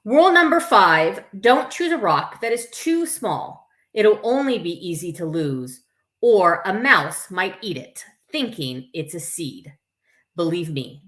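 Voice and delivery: put-on lively read-aloud to children; story reading. A narrator's reading. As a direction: read it to a circle of kids. Reads picture book aloud.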